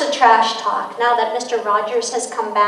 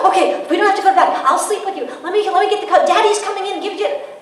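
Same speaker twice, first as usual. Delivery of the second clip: higher, quick, eager giving in; caving parent; 8 semitones higher, 3 dB louder, faster than usual. Retold or imitated. Parent caving to a crying child at bedtime. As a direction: rush to soothe and give in. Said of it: "You basically say".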